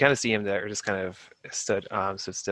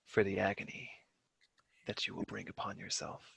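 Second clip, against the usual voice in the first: menacing whisper; 7 dB quieter than usual. Acted. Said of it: "really deep voice".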